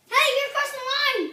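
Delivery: loud high call-out; shouting. Real, not acted. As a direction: call out the foul loudly. Calling out a foul.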